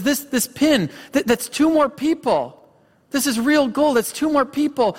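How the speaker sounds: retold anguish in a high, urgent voice; high-pitched imitation of a man losing it; distraught breakdown. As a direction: reproach yourself desperately, as if counting lives. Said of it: "he breaks down and he's crying".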